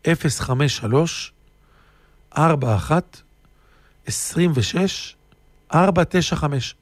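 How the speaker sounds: slow digits, pausing between groups; slowly repeated. Real, not acted. Speaker repeats the phone number for listeners, slowly. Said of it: "אני חוזר לאט לאט".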